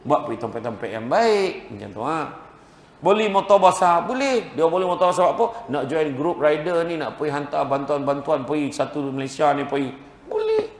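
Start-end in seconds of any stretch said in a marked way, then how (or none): 3.0-10.7 high, loud, urgent sermon push; fiery preaching. Acted. Preacher urging the crowd to weep for God, fiery preaching. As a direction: drive it home to the congregation.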